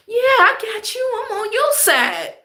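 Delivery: very high, falsetto fake-friendly voice; mock sweet high voice. Mocking a two-faced worker in a high sugary voice. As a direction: squeak it out like a phony ally.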